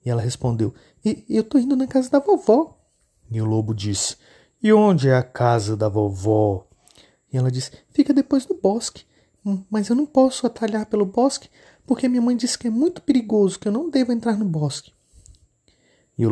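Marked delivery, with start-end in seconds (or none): 1.0-2.8 put-on much higher, childlike little girl voice. Menina responde ao lobo. 4.6-6.7 slow, lightly voiced sly wolf; wolf fishing for directions, a hint of menace. Lobo pergunta. 7.9-14.9 put-on much higher, childlike little girl voice. Menina explica ao lobo.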